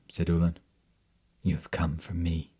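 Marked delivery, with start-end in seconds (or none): 1.4-2.5 whispering. Acted. Captor answering Ullin's plea.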